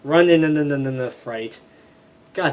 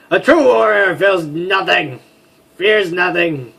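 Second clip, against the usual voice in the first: louder, grand proclamation; bold warrior declaration; 6 semitones higher, 9 dB louder than usual. Acted. Warrior boasting to companions, loud and grand. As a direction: declaim it like a battle creed.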